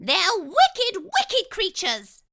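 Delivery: squeaky and emphatic, slowing; high mouse voice. Acted. Dentist warning about foxes, high squeak. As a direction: lean on wicked in a little voice.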